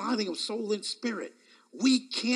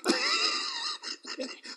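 high laugh; 4 dB quieter than usual. Real, not acted. Laughs at own joke.

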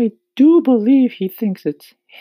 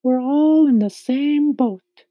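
high ladybug voice, reassuring; kindly ladybug; 7 dB louder than usual. A narrator's reading. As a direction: kindly comfort in a high-pitched voice. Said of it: "the ladybug said kindly".

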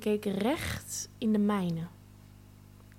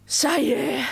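exclaimed; 2 semitones higher, 4 dB louder than usual. A narrator's reading. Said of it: "riep ze uit".

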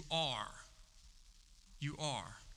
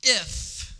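mocking devil; 6 semitones higher, 5 dB louder than usual. Devil's taunting "if.

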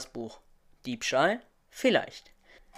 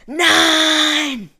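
piercing high shriek, much louder; shrill scream; 16 dB louder than usual. Acted. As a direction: scream it out in horror, high and long. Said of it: "Die schrille Stimme der Bibliothekarin".

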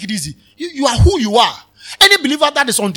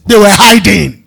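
louder shout of they were hiding; 8 dB louder than usual. Shown as a demonstration. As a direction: shout it out to the congregation. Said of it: "Should I shout it?".

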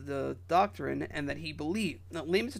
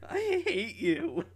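higher, slow, laughing mock insult; 9 semitones higher, slower than usual. Real, not acted. Co-host laughing at his partner's antics. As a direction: laugh it out at him.

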